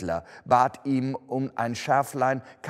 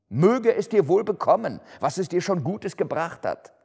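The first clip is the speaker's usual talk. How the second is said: sarcastic Scrooge; 8 semitones higher, 6 dB louder than usual. A narrator's reading. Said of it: "entgegnete Scrooge".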